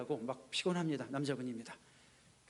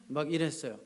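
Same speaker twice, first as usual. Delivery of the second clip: normal talking voice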